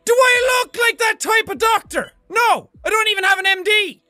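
loud, fast, high-pitched indignant tirade; exasperated outburst at full tilt; out-of-character rant. Acted. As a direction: drop the accent and rant rapidly. Drops the doctor act, ranting.

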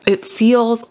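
talking around a wad of gum; chewing gum. Real, not acted. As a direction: chew gum while you speak. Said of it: "with gum in my mouth".

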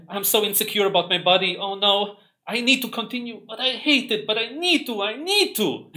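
mocking an insecure gym-goer, high and whiny; mock whining. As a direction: squeak it out like a panicking slave. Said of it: "your face expression is gonna be like this".

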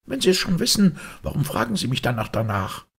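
high-pitched irritated retort; snappish. Acted. Maid retorts to Holmes's probing, high and snappish. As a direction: throw the question back crossly.

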